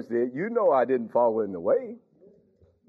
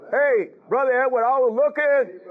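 calling out; 9 semitones higher, 4 dB louder than usual. Real, not acted. Calling out to a congregant.

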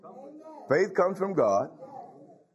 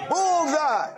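high ringing cry of victory; triumphant high exclamation; 10 semitones higher than usual. Real, not acted. Preacher celebrating his hit point, high and triumphant. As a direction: crow it out in triumph.

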